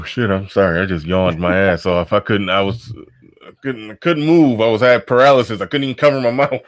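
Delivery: normal talking voice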